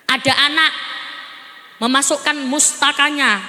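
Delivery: ordinary delivery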